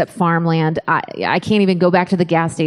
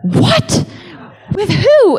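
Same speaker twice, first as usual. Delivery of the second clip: high-pitched shocked husband; freaking out; 13 semitones higher, much slower than usual. Retold or imitated. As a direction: shriek it in mock alarm. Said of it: "David is, like, freaking out".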